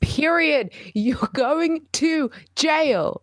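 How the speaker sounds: high, emphatic, drawn-out pronouncement; mock verdict. Passing sentence on adult babies, mock-outraged verdict. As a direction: hammer each word slowly and high.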